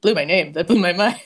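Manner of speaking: usual speaking voice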